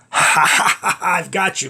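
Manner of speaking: loud breathy mock laugh into a gloating line; Dracula's gloating laugh. Dracula laughing at the dreamer he has caught. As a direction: cackle like a vampire who has caught his prey. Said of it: "he laughed".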